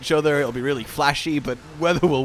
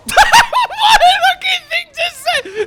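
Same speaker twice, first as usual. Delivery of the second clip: laughing shout; 9 dB louder, slower than usual. Real, not acted.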